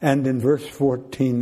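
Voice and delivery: usual speaking voice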